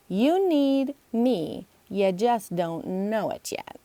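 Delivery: very slow, smug teasing; cocky and slow. Acted. Emily smugly tells Logan he needs her, slowly. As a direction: savor each word.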